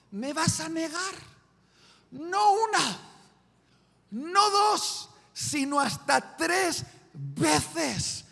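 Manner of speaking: rising high and loud, each count hammered; Jesus loudly foretelling the three denials; emphatic prophecy. Acted. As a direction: build it up to a forceful climax. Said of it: "con contundencia le dice".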